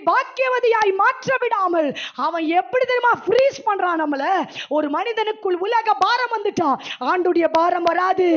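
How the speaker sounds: impassioned, insistent sermon delivery; forceful preaching. Real, not acted. Preacher urging women to weep for the nation, forceful preaching. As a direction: preach it with rising urgency.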